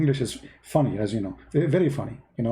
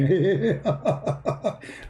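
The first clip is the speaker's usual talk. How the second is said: higher, drawn-out chuckle; amused laugh; 4 semitones higher than usual. Real, not acted. Host amused by a viewer's teasing in Arabic, real laugh. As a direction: break into a run of giggling laughter.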